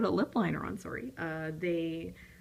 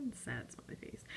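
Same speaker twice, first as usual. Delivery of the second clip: breathy whisper, very soft; a soft whispered joke; whispered aside; 7 semitones lower, 12 dB quieter than usual. Real, not acted. Whispered aside about the smiley face.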